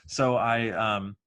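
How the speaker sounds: usual speaking voice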